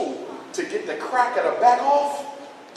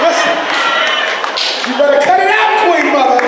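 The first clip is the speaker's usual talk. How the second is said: loud, high mock yell at the teacher; loud high-pitched scolding; shouting mother; 5 semitones higher, 8 dB louder than usual. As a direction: shout it like an angry mom. Mother yelling at teacher bit.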